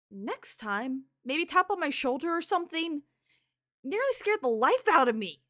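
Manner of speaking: loud, high, rattled complaint; startled scolding. Acted. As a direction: scold loudly after a fright. Startled character scolding the visitor.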